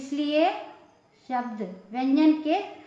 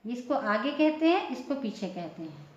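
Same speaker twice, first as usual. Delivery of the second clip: usual speaking voice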